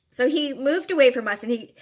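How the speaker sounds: ordinary delivery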